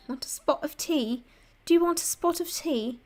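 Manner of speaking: put-on playful posh English accent; British accent. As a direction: ham up an English tea offer. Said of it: "how good my British accent".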